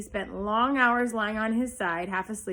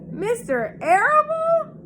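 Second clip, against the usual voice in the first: high, slow, sobbing cry; 7 dB louder, slower than usual. A narrator's reading. Pig sobs on hearing the farmer will kill him, high and slow. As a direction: break into a wail of disbelief. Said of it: "sobbed Wilbur".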